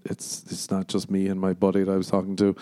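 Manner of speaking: ordinary delivery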